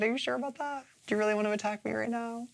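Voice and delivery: soft, high mock challenge; imitating Abora. Host quoting Abora's comeback to an attack, soft high mock challenge. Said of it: "then Abora's like".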